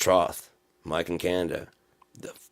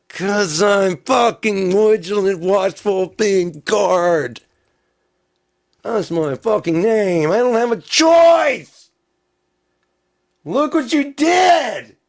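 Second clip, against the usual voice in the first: slow, high, full-volume screaming; furious, drawn-out shrieked rant; enraged screaming; 13 semitones higher, 12 dB louder, slower than usual. Acted. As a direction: scream every word as an accusation. Screaming in the vigilant guard role.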